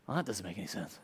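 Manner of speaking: low, very quiet grumble; imagined doubter. Acted. Imagined listener doubting the priorities, low muttered objection. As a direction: drop right down to a soft aside.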